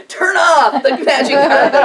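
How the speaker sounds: loud shouted chant, laughing; crowd yelling. Retold or imitated. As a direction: shout it like the whole mountainside. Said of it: "I have a mountain chorus going".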